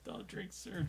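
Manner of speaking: mock teacher warning. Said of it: "teachers used to be like".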